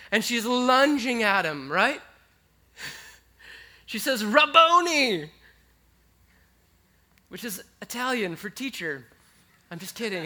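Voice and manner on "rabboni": high, loud, drawn-out cry; joyful cry. Acted. Mary lunging at Jesus, crying out joyfully. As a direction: call out as you run to him.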